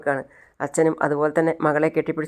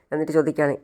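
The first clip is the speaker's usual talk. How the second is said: usual speaking voice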